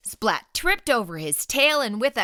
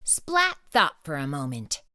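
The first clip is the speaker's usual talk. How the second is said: plain reading voice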